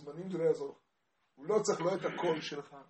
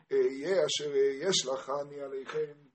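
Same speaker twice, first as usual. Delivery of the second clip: slow, stretched recitation in God's words; sing-song preaching; 7 dB louder, slower than usual. Retold or imitated. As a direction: intone it slowly, drawing out phrase endings. (דרשה בניגון איטי)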